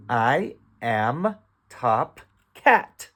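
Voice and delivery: each word of "I am top cat" drawn out and separate; very slow, deliberate reading, lifting on "cat"; slow beginner reading. Shown as a demonstration. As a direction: read word by word for a new reader. Teacher reads decoded sentence slowly.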